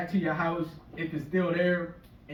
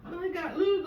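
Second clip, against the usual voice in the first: high breathy sultry ad voice, hammed up; mock Halle Berry; 12 semitones higher than usual. Comic mocking the actress's ad in a high sultry voice. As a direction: purr it like a lube commercial. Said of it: "And she's laying in the bed".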